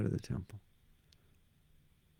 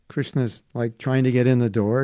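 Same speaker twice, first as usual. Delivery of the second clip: usual speaking voice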